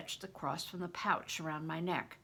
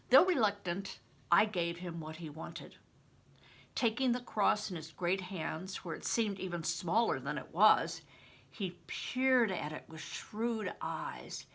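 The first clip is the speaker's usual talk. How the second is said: plain reading voice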